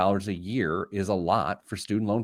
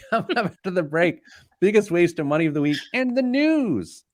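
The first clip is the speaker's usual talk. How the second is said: announcer voice; 8 semitones higher, 3 dB louder than usual. Acted. Radio show break tease, retake.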